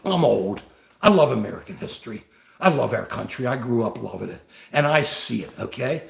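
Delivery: welling up over his country; getting emotional. Real, not acted. Streamer tearing up to viewers, softly emotional. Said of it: "I get emotional about it".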